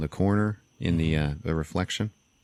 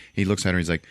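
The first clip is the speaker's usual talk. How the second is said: ordinary talk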